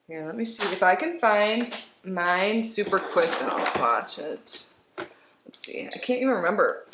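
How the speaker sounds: ordinary delivery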